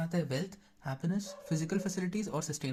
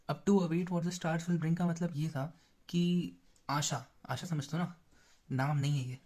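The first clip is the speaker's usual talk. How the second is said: normal talking voice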